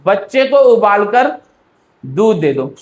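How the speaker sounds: बच्चे को उबाल कर as one run, long gap, दूध दे दो; misplaced pause after उबाल कर. Shown as a demonstration. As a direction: split it wrongly, cutting off दूध दे दो after उबाल कर. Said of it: "ऐसे ही तो बोल सकता हूँ".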